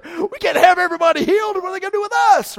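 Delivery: high, loud, fast panicked leaders; religious leaders panicking over the healing, shouting; panicked council. Acted. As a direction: blurt it out in alarm.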